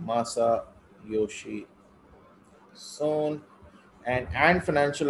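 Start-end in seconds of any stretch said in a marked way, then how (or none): 0.1-3.4 Masayoshi stretched very slowly and softly; slow and careful. Shown as a demonstration. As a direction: dictate Masayoshi Son slowly. Teacher repeats name for notes.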